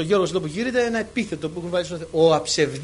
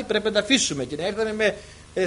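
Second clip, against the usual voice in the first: normal talking voice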